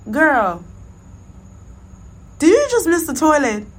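loud, high call from the next stall; friend calling out. Retold or imitated. Friend shouting from the next stall, loud and high. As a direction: yell it across to the next stall. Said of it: "my homegirl was like".

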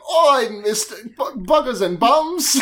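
slow, drawn-out mock Cockney; exaggerated Cockney. Host showing what Cockney is, exaggerated Cockney. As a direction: ham up an old-style Cockney accent. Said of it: "the really exaggerated".

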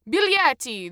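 loud, high Italian shout; insistent demand. A narrator's reading. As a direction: insist loudly, as a ticket checker. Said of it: "the man insisted again".

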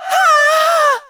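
piercing high loud scream; terrified scream. A narrator's reading. Cassie caught by the vines, screaming in terror. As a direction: let out a sharp scream of terror. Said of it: "voice just screamed".

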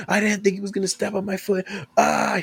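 high-pitched mock complaint; hammed-up, pained protest ending in a cry; imitated tearful whine. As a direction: play the injured woman wailing to her friend. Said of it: "she's, like, crying".

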